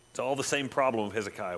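usual speaking voice

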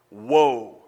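loud guttural "Woe" torn out; guttural cry. Retold or imitated. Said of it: "That guttural sound".

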